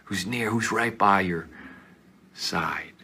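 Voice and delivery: demonstrating a close whisper. Shown as a demonstration. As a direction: whisper as if to someone at your side. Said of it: "you whisper to somebody".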